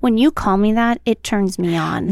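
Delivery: low, slowing come-on; mock seductive. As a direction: tease with a put-on sultry drop in pitch. Teasing co-host with mock seduction.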